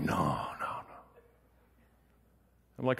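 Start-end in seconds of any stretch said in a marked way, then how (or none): 0.0-1.0 whispered, low and soft; hushed no. Retold or imitated. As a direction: breathe the no's in a hush. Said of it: "They're like".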